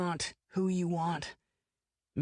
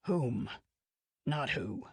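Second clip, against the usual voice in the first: correcting; 4 semitones lower, slower than usual. A narrator's reading. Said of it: "Dubose corrects".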